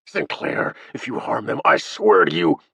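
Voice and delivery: contained anger that rises at the end; angry, barely contained. A narrator's reading. Captive major warning Sinclair, anger barely contained. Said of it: "angry, barely contained".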